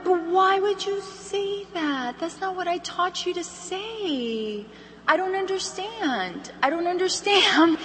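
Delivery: slow, high mock bewilderment; hammed-up pained puzzlement, dragging every phrase; baffled sales director. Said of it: "heard your director say this".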